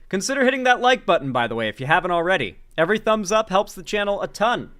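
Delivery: ad read. Acted. Like-button plug.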